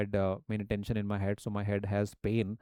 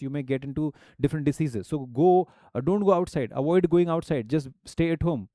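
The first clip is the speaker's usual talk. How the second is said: Indian English accent, louder, sentence ends stressed; Indian accent demo; 3 semitones higher, 3 dB louder than usual. Shown as a demonstration. Teacher demonstrating an Indian accent for the class. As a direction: speak it with an Indian accent, punching the last words. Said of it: "I'm speaking now the Indian accent".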